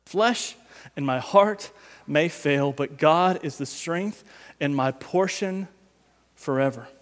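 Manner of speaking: slow quiet reading. Acted. Slow soft scripture reading.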